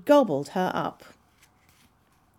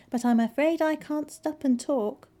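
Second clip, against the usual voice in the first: higher-pitched polite refusal; courteous storybook excuse, pitched up; 8 semitones higher than usual. A narrator's reading. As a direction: decline politely as the little girl. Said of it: "she said politely".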